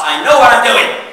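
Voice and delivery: loud, laughing, hammed-up certainty; laughing mock insistence. Shown as a demonstration. Presenter playing a lost driver, laughing and loud. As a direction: shout it through a laugh. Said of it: "Any guys relate to this?".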